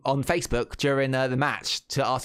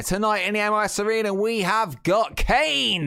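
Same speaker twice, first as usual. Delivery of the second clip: ring announcer; 9 semitones higher than usual. Acted. Announcing the main event.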